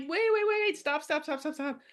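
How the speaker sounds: high-pitched rapid-fire repeats, fading; urgent interrupting plea. Acted. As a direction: cut off the clamor, pleading for a halt. Calming the yelling turtles.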